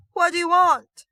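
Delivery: put-on scared boy wailing from the rope; terrified wail. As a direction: shriek it out in panic, hitting want. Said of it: "Ash wailed".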